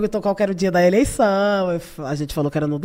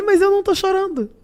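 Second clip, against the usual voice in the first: high mock stern reply; a light imitation of a stern leader; imitating Lula; 12 semitones higher than usual. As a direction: play the president brushing it off.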